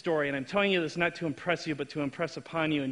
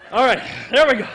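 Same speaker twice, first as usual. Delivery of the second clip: hyped shout; 5 semitones higher, 10 dB louder than usual. Real, not acted. Hyping crowd.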